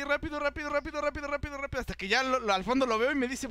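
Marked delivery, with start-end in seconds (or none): 0.0-1.8 high, rushed chant of rápido; hurried. Retold or imitated. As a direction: rattle it off urgently on one raised pitch. Acts out hurried walk away. (apurado, repitiendo en tono agudo)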